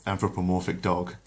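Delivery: usual speaking voice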